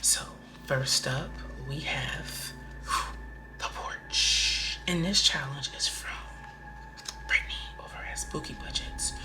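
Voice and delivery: whispery spooky storyteller. Pretend. Host opening a spooky budget challenge, pretend spooky voice. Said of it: "put my spooky voice on".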